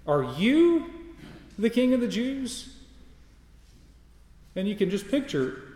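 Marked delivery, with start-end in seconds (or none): 0.1-2.7 "you" pitched up and stretched, the whole question rising higher; incredulous weight on "you", pitched up; stress on YOU, higher. Shown as a demonstration. Preacher repeating Pilate's question with "you" sharply stressed. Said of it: "you" is emphatic".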